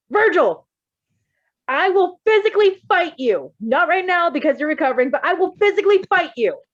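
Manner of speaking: loud, high, fierce yell at Virgil; shouted threat. Acted. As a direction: bellow the threat with full force. Character yelling threat at Virgil.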